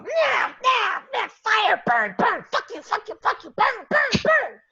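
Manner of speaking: very high-pitched little monster chant; gleeful magmin. Acted. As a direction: squeal it like a pack of tiny fire goblins. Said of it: "you just hear the magmins go".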